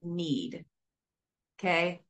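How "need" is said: "need" set apart after a slow lead-in; slow down and land deliberately on "need"; stress on need. Shown as a demonstration. Stressing to listeners that people need sobriety, deliberate stress on "need". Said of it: "I stress the word need".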